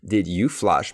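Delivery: "Did you flush" with a full, separate "you"; slow, careful "Did you flush"; said slowly. Shown as a demonstration. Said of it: "quand je le dis lentement".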